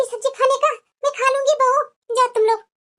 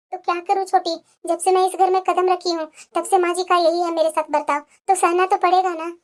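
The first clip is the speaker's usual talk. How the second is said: lower, timid voice of a downtrodden wife; meek elder daughter-in-law; 7 semitones lower than usual. Acted. Elder bahu to younger sister-in-law, meek and resigned. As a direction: sigh it out like a bullied bahu.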